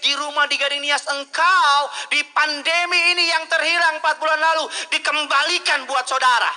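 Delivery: loud, high preacher's cry; fiery preaching. Acted. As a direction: proclaim it to the church at full force. Preaching at full force. (khotbah berapi-api dengan suara lantang)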